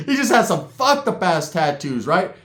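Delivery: normal talking voice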